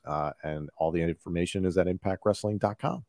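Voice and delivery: normal talking voice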